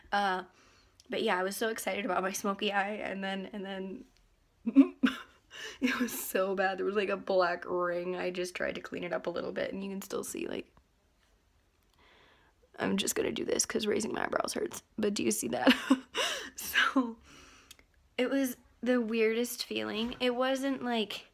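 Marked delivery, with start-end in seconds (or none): 4.6-5.2 short high laugh that bursts out; burst of laughter. Real, not acted. Vlogger laughing at her ruined smoky eye, bursting laugh. 15.8-16.5 quick high-pitched giggle. Real, not acted. Showing her new piercing to the camera, high giggle.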